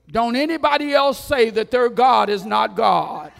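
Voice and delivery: roaring royal command, full force; king's decree shout. Acted. Preacher booms the king's decree to the crowd, shouting. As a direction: bellow the decree like a furious king.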